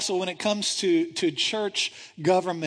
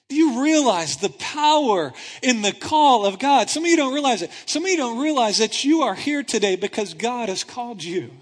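louder preaching, punching every "you"; preaching at full force; 4 semitones higher, 4 dB louder than usual. Acted. Preacher pressing the congregation hard, full-force preaching. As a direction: drive it into the congregation. Preacher drives home the call.

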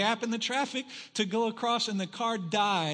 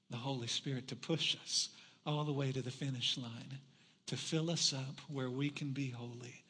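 soft, lowered sermon-closing prayer; earnest prayer; 5 semitones lower, 7 dB quieter than usual. Real, not acted. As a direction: pray quietly and humbly, leaving pauses. Moves from story into prayer.

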